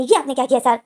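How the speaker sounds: loud, sharp angry question; angry outburst. Acted. Assistant to his boss over spilled food, loud angry. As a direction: snap it out, furious at the mess. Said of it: "assistant जो गुस्से से बोलता है".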